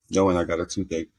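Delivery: ordinary talk, nothing marked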